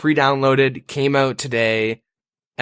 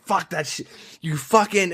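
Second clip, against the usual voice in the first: angry swearing; 5 semitones higher than usual. Retold or imitated. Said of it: "I get really mad over jump scares".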